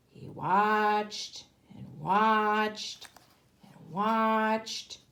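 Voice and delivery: very slow, stretched out watching; drawn out. A narrator's reading. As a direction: draw each watched out long. Narrator stretches watching time.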